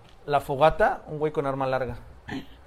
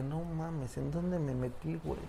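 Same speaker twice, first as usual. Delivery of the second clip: susurro asustado; 10 dB quieter, slower than usual. Retold or imitated. Los pintores asustados susurran.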